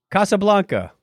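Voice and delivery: ordinary delivery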